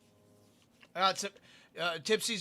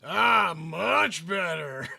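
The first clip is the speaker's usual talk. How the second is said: relieved sigh; 6 dB louder, much slower than usual. Real, not acted. Relief after escaping.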